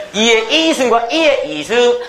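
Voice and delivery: slow, high-pitched Gyeongsang tone contrast; Gyeongsang accent. Shown as a demonstration. Teacher joking about Gyeongsang speakers, exaggerated Gyeongsang accent.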